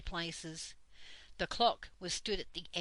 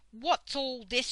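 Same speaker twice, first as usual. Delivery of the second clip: louder, higher furious demand; fierce shout; 6 semitones higher, 6 dB louder than usual. A narrator's reading. Dame Slap catching the children misbehaving, fierce shout. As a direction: roar at the unruly room. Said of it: "she shouted, in a very fierce voice".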